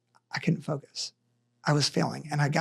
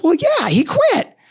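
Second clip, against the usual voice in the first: boss voice; 11 semitones higher, 5 dB louder, slower than usual. Retold or imitated. Boss's casual reply voiced.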